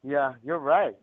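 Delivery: normal talking voice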